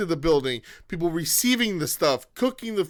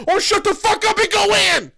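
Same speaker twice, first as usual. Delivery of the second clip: high, loud ranting outburst; exasperated shout; 13 semitones higher, 6 dB louder than usual. Real, not acted. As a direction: yell it at the complainers, fed up. Rant at complainers peaks.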